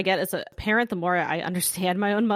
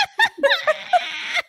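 high laughing squeal; 5 dB louder than usual. Real, not acted. Laughs at podcast joke.

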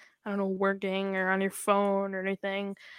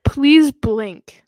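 loud plea; 4 semitones higher, 11 dB louder than usual. Acted. Pleading reminder to listeners.

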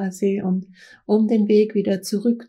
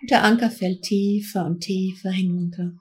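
guided meditation. Acted. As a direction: guide them gently into trance. Leads a guided trance journey.

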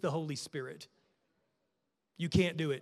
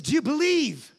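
higher, louder question from Jesus; calling question; 7 semitones higher, 6 dB louder than usual. Retold or imitated. Jesus asking the father of the sick boy, ringing question. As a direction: ask it out strong.

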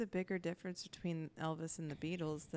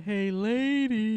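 loud, slow, higher hawker voice; vendor voice; 3 semitones higher, 12 dB louder, much slower than usual. Pretend. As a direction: call out like a hammy peanut vendor. Playful vendor voice bit.

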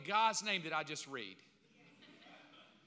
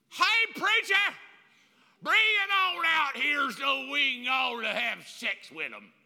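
slow, loud, higher mock mob holler; rowdy neighbors; 5 semitones higher, 7 dB louder, slower than usual. Preacher imagines neighbors calling from his lawn, slow loud holler. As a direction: drag it out like a crowd hollering at the house.

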